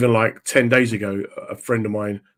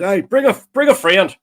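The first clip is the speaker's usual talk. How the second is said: put-on loud, high Ballymena accent; Northern Irish accent; 8 semitones higher, 5 dB louder than usual. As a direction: call it out as a Northern Irish local. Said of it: "He goes".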